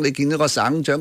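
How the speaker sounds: normal talking voice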